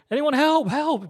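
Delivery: high, loud imitated call for rescue; cry for help. Father dragged into the building calls to bystanders, mock cry for help. Retold plea while dragged inside.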